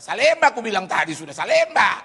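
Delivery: loud, exasperated bus-route shout; shouted call. Retold or imitated. Bus man answering where he is going, loud shouting. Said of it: "Dia teriak-teriak".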